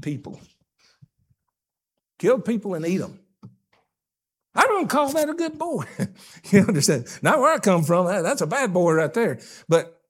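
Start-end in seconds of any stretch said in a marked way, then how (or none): 4.5-5.8 indignant. Acted. Preacher's indignant retort.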